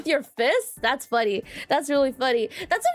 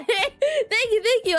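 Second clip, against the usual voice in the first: laughing thanks; 7 semitones higher, much slower than usual. Real, not acted. Thanks chat, laughing at herself.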